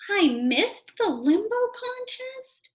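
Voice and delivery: high, slow shocked question; high slow dismay; dismayed disbelief. Acted. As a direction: gasp it out in disbelief. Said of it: "Stanley dismayed".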